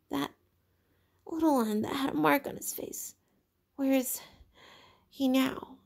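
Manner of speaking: put-on a scared boy searching the crowd; frightened Piggy.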